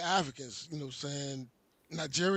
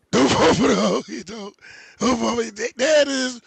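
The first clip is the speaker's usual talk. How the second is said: louder, rising call-out of the warning; emphatic repeat; 2 semitones higher, 9 dB louder than usual. Real, not acted. Host to viewers, repeating a warning louder. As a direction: drive the advice home.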